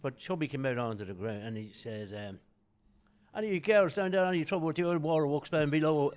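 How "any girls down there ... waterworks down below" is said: stage call. Retold or imitated. Comedian calling to the crowd.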